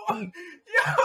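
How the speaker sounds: laughing while choking on water; coughing through laughter. Real, not acted. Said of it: "It went down the wrong pipe".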